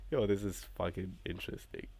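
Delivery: ordinary delivery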